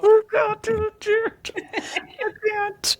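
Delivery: choked, breathy voice on the edge of crying; fighting back real tears; holding back tears. Real, not acted. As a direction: voice cracking as she tries not to cry. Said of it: "I'm trying not to cry".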